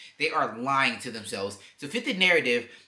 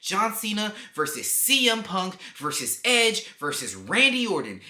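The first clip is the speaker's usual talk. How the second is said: normal talking voice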